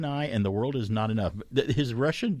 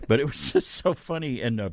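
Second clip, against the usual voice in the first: ordinary talk, nothing marked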